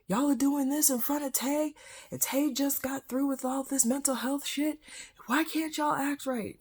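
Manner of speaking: high-pitched, indignant mock tirade; furious brother. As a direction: act out an outraged brother scolding the parents. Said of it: "my brother came home and was furious".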